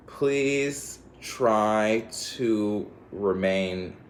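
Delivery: very slow, drawn-out plea; drawn-out pleading; slow earnest plea. Real, not acted. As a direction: stretch each word to plead.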